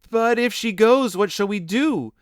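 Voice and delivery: put-on children crying in despair. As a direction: cry out in despair. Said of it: "they cried in despair".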